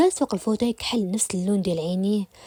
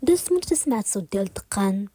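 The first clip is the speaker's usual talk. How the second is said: ordinary delivery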